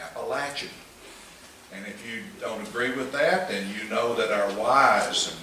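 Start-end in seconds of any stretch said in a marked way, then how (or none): 0.0-0.7 Appalachian with a short "latch" vowel; the approved pronunciation. Retold or imitated. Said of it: "Charles said it exactly right".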